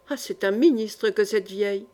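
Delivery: ordinary delivery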